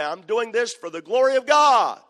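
loud, high mock piety, sneering at the excuse; hammed-up sanctimonious claim; mocking drinker. Preacher mocking a drinker's excuse to his congregation, loud mock piety. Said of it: "and say".

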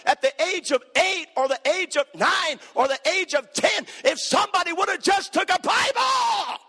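shouted high-pitched preaching crescendo; fiery revival preacher yelling; preaching at full force. Real, not acted. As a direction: lift it to a roaring pulpit shout.

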